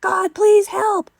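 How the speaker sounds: long, very high, loud wail; imitated child screaming a prayer; child's scream. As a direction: draw out each word in a desperate scream. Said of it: "And he screamed".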